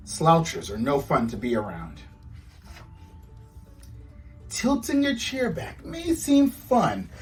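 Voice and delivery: plain reading voice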